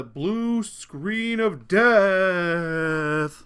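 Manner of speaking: loud, drawn-out mock-dread announcement; mock doom. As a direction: stretch it out like a melodramatic doom pronouncement. Dramatises a computer crash.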